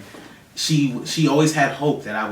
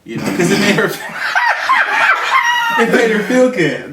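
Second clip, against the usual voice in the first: laughing; 13 semitones higher, 12 dB louder than usual. Real, not acted. Laughing while telling story.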